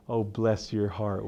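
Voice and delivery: slow, syrupy Southern drawl; sweet-tea Southern lady voice, lightly done; Southern bless your heart. Retold or imitated. Stubborn Mercy sounding sweetly Southern, slow drawl.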